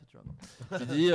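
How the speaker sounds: ordinary talk, nothing marked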